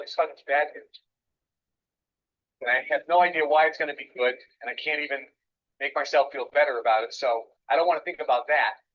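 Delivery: loud, worked-up inner voice of someone who feels awful; hammed-up despair, thinking aloud; despairing self-talk. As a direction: vent the bad mood out loud. Acting out a person feeling bad.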